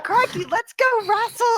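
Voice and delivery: high hammed-up Aussie, laughing; Australian accent. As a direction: play a cartoon Crocodile Dundee. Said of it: "I can do an Australian accent".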